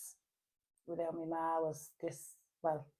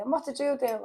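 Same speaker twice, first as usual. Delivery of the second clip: higher, louder imitation of mum; retold alarmed question; 8 semitones higher, 5 dB louder than usual. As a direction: demand it like a startled mum, retold. Retold mother questioning her.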